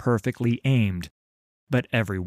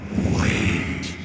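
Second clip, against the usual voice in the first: cold hissing layered whisper; 3 dB louder than usual. Acted. Thresh's voice.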